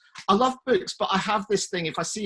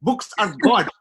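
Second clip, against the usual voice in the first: loud, high, slow parental decree; hammed-up parent voice, high and emphatic; imitated scolding parent; 8 semitones higher, 8 dB louder than usual. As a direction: lay down the law like an outraged parent.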